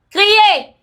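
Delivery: sudden loud high shout. Shown as a demonstration. Guest urging women entrepreneurs to make noise, shouted. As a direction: yell it out as a command. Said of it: "S'il faut crier".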